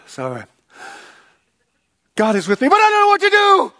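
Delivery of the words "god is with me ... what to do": high, loud, rushed despair; desperate plea. Retold or imitated. Husband repeats the prayer but breaks into panic, high and loud. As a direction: cry it out, overwhelmed. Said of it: "I said".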